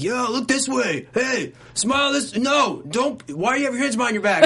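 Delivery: high-pitched photographers' shouts; imitated yelling. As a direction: mimic a crowd calling out orders. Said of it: "thirty people yelling at you".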